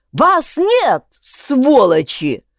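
loud, slow dragged-out curse; furious yell. A narrator's reading. Egorov yelling that the voices are not real, furious. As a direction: yell them out of existence. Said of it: "заорал Егоров".

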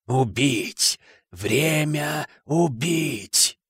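very slow, fading murderous creature; icy voice fading. A narrator's reading. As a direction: let the menace draw out and recede. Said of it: "Голос становился слабее". (ледяной, затихающий голос)

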